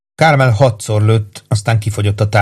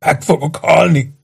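high, strained whimper; pained groaning plea; 6 semitones higher than usual. A narrator's reading. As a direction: moan it like a tortured man. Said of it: "nyöszörögve felé fordult".

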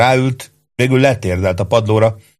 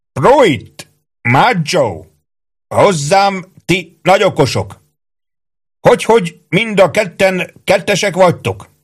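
high, raised, accusing call; yelling sergeant; 9 semitones higher, 4 dB louder, slower than usual. A narrator's reading. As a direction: summon the culprits like a drill sergeant. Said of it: "ordította Ike". (magas, számonkérő őrmesteri kiabálás)

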